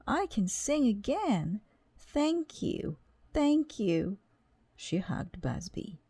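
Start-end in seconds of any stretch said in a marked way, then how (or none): 0.1-4.2 high lady voice. A narrator's reading. Happy opera lady voiced.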